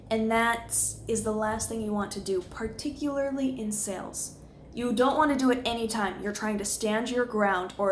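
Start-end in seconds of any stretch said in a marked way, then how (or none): none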